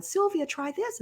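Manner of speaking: high, soft imitation of eager sisters; retold coaxing.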